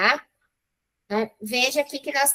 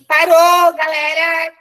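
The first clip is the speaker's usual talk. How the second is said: louder, higher call to stop; 4 semitones higher, 7 dB louder, slower than usual. Real, not acted. Teacher calling time on the three minutes, loud call.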